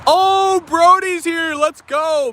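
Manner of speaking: high, drawn-out cheer of welcome; excited greeting. Real, not acted. Streamer spots Brody back in the chat, excited. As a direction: burst out high, thrilled at who just arrived. Regular viewer turns up in chat.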